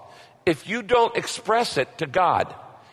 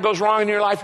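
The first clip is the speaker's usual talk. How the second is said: ordinary delivery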